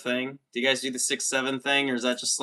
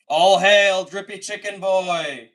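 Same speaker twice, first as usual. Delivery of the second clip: loud, slow ceremonial proclamation; hailing; 6 semitones higher, 3 dB louder, slower than usual. Acted. Streamer proclaiming the new moderator, loud and slow. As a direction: call it out like heralding royalty. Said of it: "I have to knight him".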